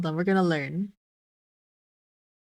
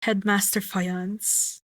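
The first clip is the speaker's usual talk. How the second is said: slow, grand title; slowly trying out a grand title; slower than usual. Shown as a demonstration. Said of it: "trying titles".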